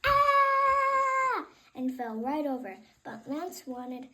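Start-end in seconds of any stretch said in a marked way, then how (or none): 0.0-1.5 high loud shriek; high startled yelp; yelled scream. Acted. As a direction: scream in fright. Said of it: "the lady yelled".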